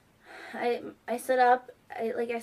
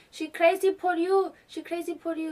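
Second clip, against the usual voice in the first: grandma's broken English; 7 semitones higher, 4 dB louder than usual. Retold or imitated. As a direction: mimic grandma's halting English. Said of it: "trying to speak English".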